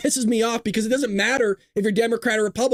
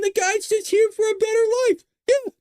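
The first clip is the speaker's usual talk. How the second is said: much higher, gushing mock voice, rising to a shout; mocking naive liberal; 11 semitones higher than usual.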